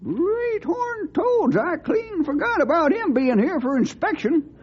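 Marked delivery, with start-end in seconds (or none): 0.0-1.6 slow, higher gasp of an oath; startled exclamation. Acted. Old cowhand realises he forgot the colonel's visit, slow startled oath. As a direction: blurt it out, dragging each word.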